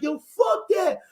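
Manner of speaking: hammed-up street yell; retold shout. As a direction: throw it out like a dare. Friend's retold defiant outburst.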